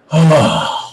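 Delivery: a long heavy sigh; imitated deep sigh. Husband mimics his wife's sigh at the cost, long deep sigh. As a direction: let out a big weary breath. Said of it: "she took a huge deep breath".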